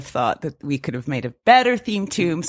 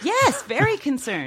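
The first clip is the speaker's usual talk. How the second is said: higher, much softer, slow dry sarcasm; deadpan sarcasm; 8 semitones higher, 6 dB quieter, slower than usual. Real, not acted. Co-host dryly agreeing that listeners will worry, deadpan. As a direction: drag it out with mock gravity.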